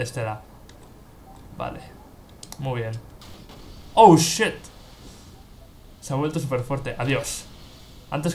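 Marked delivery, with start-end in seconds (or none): 3.9-4.5 loud, high startled curse; real dismay, louder; alarmed surprise. Real, not acted. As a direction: caught off guard, blurt it. Enemy hits very hard.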